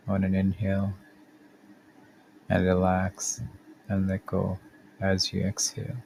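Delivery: calm, measured body-scan guidance with long pauses; guided meditation. Acted. Podcast host guiding listeners through a body scan, calm meditation voice. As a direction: lead the listener gently through each step, unhurried.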